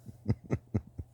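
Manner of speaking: breathy, unvoiced chuckle; laugh. Real, not acted. Amused by the old man's criticism, breathy chuckle. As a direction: chuckle to yourself without much voice. Laughing at his own story.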